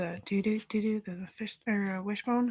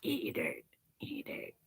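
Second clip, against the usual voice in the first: fading whisper; 9 semitones lower, 4 dB quieter, slower than usual. Real, not acted.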